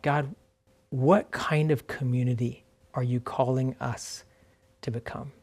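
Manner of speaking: quiet prayerful question. Real, not acted. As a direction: ask God softly, pausing between phrases. Prayerful question put to God.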